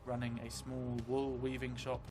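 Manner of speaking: ordinary delivery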